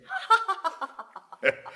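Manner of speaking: a sudden high burst of laughter. Acted. Don Juan laughing at his own reckless gushing, high laugh.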